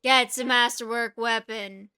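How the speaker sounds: ordinary talk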